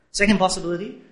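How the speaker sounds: ordinary delivery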